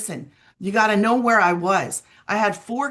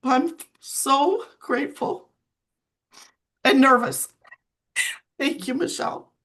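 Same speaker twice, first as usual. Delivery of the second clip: higher, tearful voice breaking; grateful words through real tears; crying; 6 semitones higher than usual. Real, not acted. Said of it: "I'm sorry for crying".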